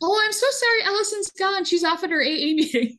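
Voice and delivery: high, hammed-up fake apology; mock apologetic. [name] on the phone exposing Allison, mock apologetic.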